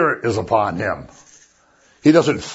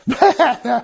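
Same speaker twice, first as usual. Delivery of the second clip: laughing, pitched up; breaking into laughter mid-sentence; 11 semitones higher than usual. Real, not acted. Laughing at picture of Mary.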